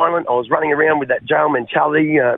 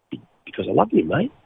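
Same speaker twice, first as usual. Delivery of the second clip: soft and tender, lower; 3 semitones lower, 4 dB quieter than usual. Retold or imitated. The boss's wife tells the addict, soft and tender. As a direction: say it gently, meaning it. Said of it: "she said".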